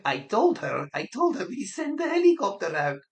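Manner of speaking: high, loud gleeful gossip voice; giggling boast. Pretend. Operator Patricia tells Manuela about the prank, giggly. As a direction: imitate a colleague bursting to share a prank. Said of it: "Patricia and Manuela having a right giggle".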